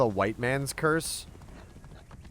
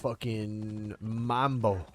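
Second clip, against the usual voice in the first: slow, softer catchphrase delivery; drawn-out; 5 dB quieter, much slower than usual. Pretend. Streamer toying with the Mambo catchphrase, drawn-out.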